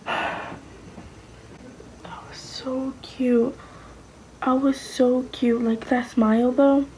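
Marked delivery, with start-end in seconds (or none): 0.1-3.6 whispered awe. Real, not acted. Seeing her baby photo.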